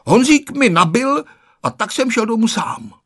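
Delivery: high-pitched self-justifying whine; defensive, pitched well up; making excuses. A narrator's reading. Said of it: "vymlouval se Ferda".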